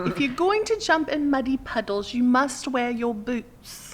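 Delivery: high, slow children's-cartoon British; British accent. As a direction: recite in a prim put-on British accent. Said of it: "watch a lot of Peppa Pig".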